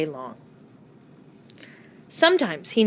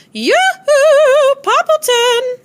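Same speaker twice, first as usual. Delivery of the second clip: high sing-song yoo-hoo call; 8 dB louder, much slower than usual. A narrator's reading. Neighbor calling over the fence in a high sing-song. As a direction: call across to the neighbor brightly. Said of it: "Cherry Sue kept calling".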